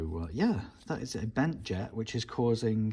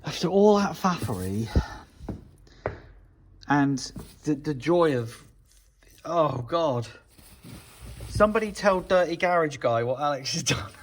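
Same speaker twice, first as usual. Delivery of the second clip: louder, higher excited burst; 6 semitones higher, 8 dB louder than usual. Real, not acted. Thrilled the carb finally works, louder and higher. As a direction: gush with delight.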